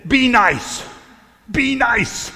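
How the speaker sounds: loud, high burst of pent-up energy; energetic shout. Retold or imitated. Clergyman to a honking driver, energetic pent-up shout. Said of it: "I said it with some energy".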